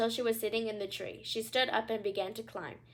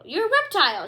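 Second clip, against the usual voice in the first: high, loud, sharp accusation; queen unmasking the crocodile angrily; snapped; 9 semitones higher, 10 dB louder than usual. Acted. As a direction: spit the word reptile out. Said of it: "she snapped".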